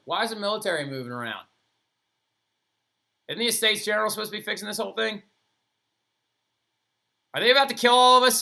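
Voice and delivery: fast, climbing from worried to loud high panic; rising panic. Retold or imitated. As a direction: speed up and build into a frightened cry. Workers panicking about military.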